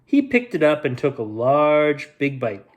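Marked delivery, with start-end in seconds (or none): none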